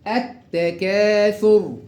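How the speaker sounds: التكاثر slowly, doubled t and long aa; slow recitation. Shown as a demonstration. Teacher shows how it is read, slow recitation. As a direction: stretch التكاثر, merging lam into t. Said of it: "يلا نقرأ التدريبات تاني".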